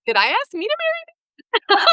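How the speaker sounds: high-pitched mock disbelief; retold incredulous question. Challenging him over who proposed, high and incredulous. Incredulous retort to his denial.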